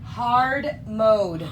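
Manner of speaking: loud, punchy echo; dramatic echo. Real, not acted. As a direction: boom it like a game announcer.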